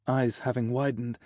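plain reading voice

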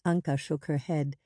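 plain reading voice

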